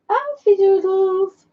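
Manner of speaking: high, drawn-out pet-name call. Real, not acted. Owner calls her dog back by nickname, calling out. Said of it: "If we shout him".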